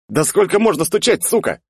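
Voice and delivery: high, loud furious yell. Acted. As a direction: scream at the door. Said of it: "заорал я".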